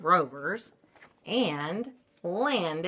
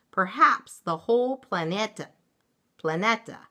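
usual speaking voice

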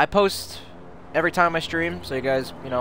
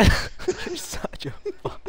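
slow breathless giggling whisper; wheezing laugh; much slower than usual. Real, not acted. Laughing too hard to speak.

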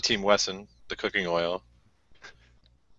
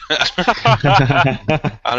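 laugh; 6 semitones higher, 6 dB louder than usual. Real, not acted. Laughing at hobo joke.

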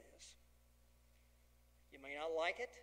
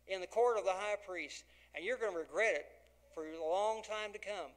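louder, rising preacher's cry; full-force preaching; 4 semitones higher, 8 dB louder than usual. Acted. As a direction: drive it home to the congregation. Preaching Jesus' prophecy at full force.

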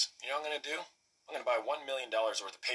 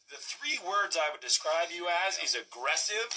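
building irate complaint; 8 semitones higher than usual. Acted. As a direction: spit out each word with rising anger. Irate client lists complaints.

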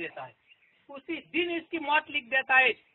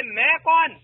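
calling out; 3 semitones higher, 8 dB louder, slower than usual. Acted. King calls to roof at night.

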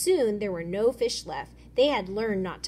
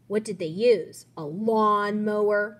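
mocking; 7 dB louder than usual. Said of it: "Miguel said as he laughed".

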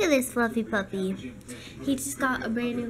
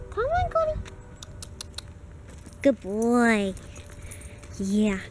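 ordinary talk, nothing marked

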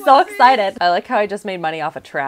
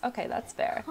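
whispered, much softer aside; 3 semitones higher, 12 dB quieter than usual. Real, not acted. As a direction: breathe it to yourself. Quiet aside to herself.

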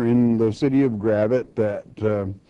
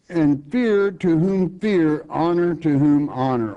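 slow, raised scripture reading to the congregation; 4 semitones higher than usual. Acted. Preacher to congregation, solemn slow scripture reading. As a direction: read the verse out like holy writ.

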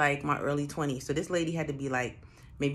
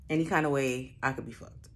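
ordinary delivery

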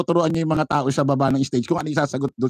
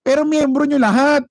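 higher, louder cry of protest; indignant outburst; 11 semitones higher, 4 dB louder than usual. Real, not acted. Vlogger retorting that all attendees are members, loud indignant. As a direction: shout the retort in disbelief. Indignant rebuttal to rally claims.

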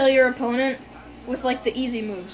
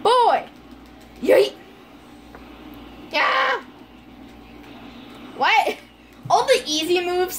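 hyped shouts; 7 semitones higher, slower than usual. Real, not acted. Gaming outbursts.

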